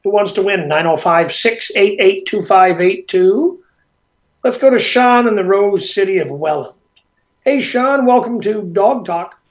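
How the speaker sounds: loud, upbeat radio host read; radio host announcer. Acted. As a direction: hype the contest caller. Radio host taking a caller.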